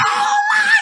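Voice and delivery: very high, loud excited shriek; fangirl shriek. Retold or imitated. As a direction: squeal like a starstruck fan. Daughter screams hearing Bobby's voice.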